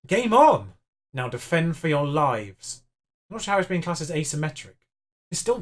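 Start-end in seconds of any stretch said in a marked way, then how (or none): 0.1-0.7 excited. Real, not acted. Equaliser just scored.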